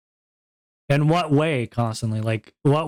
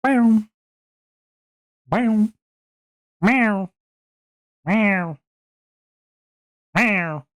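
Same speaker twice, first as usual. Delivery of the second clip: cat meows; 6 semitones higher, much slower than usual. Pretend. Repeated cat meows, fading.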